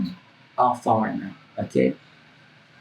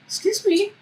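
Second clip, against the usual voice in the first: much higher offended excuse me; indignant call. Retold or imitated. Calling after the worker walking away, high and affronted. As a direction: call him back, affronted.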